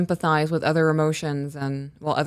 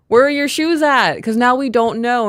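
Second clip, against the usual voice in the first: louder, higher exasperated parent; retold raised voice; 5 semitones higher, 5 dB louder than usual. Parent losing patience over missing shoes, retold scolding. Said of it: "I started raising my voice a little bit".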